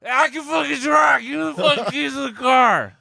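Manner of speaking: high-pitched, yelling Indianapolis caricature; Indianapolis accent. Acted. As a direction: holler in a squealed mock Indianapolis accent. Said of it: "That's how they sound".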